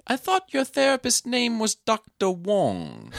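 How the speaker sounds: put-on high, slow puzzled old lady; mock old lady. Retelling The Ref, the grandmother doubting the fake therapist, high slow mock voice. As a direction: ask it with snooty doubt. Mimics old woman questioning.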